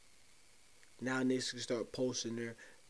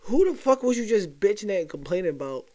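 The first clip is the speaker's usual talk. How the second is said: exasperated rant; 7 semitones higher, 10 dB louder than usual. Real, not acted. Ranting at the audience.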